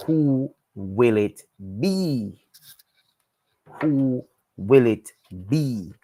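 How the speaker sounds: slow, teasing suspense; dramatic suspense. Real, not acted. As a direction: draw it out slowly, building anticipation. Host building suspense before races.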